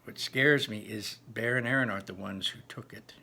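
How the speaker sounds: ordinary delivery, nothing marked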